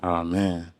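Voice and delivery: ordinary talk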